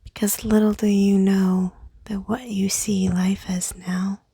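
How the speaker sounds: guided meditation. Acted. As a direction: whisper them into calm by the candle. Leads a candle meditation.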